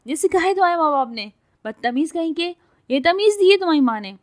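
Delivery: high, fast, loud furious yelling; furious shouting. A narrator's reading. Girl insults the man's mother, furious yelling. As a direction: rage at him, fast and loud.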